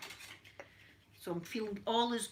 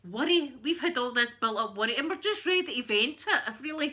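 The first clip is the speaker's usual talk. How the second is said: louder, higher worked-up burst; heated venting; 3 semitones higher, 6 dB louder than usual. Real, not acted. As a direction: let the pent-up worry pour out. Venting built up worry.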